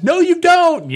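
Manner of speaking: hammed-up parent shutting it down; mock stern dad. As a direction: bark a mock no. Father refusing in acted scene.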